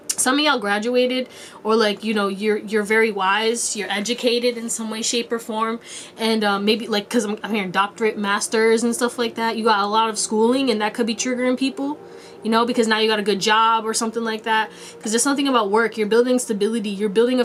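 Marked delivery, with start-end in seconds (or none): none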